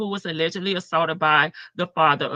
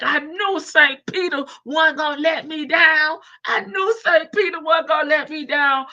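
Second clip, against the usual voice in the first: loud, higher, exultant mock holler; triumphant shout; 6 semitones higher, 4 dB louder than usual. Imagined defendant celebrating his dropped charges, loud triumphant shout. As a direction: shout it like a freed man praising heaven. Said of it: "He'll be like".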